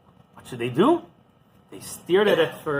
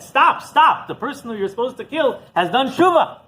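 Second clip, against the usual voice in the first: higher mock yell of the angel; retold angel's yell; 7 semitones higher, 3 dB louder than usual. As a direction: call stop, stop at the snake. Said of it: "yelling at it".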